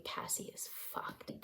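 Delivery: voiceless whisper, very soft; hushed acted thinking to oneself; inner thought. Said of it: "she's just thinking".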